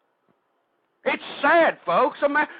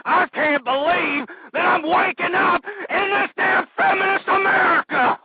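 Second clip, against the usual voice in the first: higher, crying out in despair; cracking high anguished complaint; 8 semitones higher, 3 dB louder than usual. Acted. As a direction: wail it like the country is lost. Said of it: "I'm depressed".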